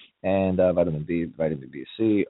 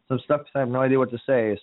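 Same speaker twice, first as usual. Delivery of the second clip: higher test voice; 5 semitones higher than usual. Shown as a demonstration. Host showing how he sounds like this, in a higher voice. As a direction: talk in a raised pitch. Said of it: "we are talking like this".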